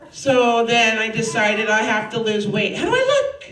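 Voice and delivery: ordinary talk, nothing marked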